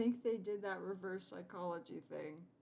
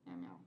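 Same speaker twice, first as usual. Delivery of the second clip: much lower, growly mock meow; 13 semitones lower than usual. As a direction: meow like a big tomcat. Imitating the cat.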